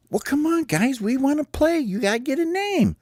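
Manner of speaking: high-pitched pleading captain; squeaky, impatient bird; impatient team captain. Acted. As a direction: hurry them along in a high voice. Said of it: "Well, Hope says".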